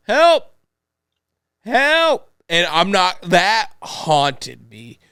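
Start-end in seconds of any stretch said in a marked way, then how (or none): 0.1-2.3 high, loud desperate cry of help; yelling for help. Retold or imitated. Retelling a haunting clip, crying out high and loud. Imitating the horror clip's cry.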